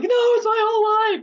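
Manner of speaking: high, loud crying protest; crying wail. Retold or imitated. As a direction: sob out the protest to mom. Crying daughter protests to mom.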